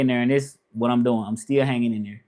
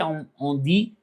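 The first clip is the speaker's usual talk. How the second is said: ordinary talk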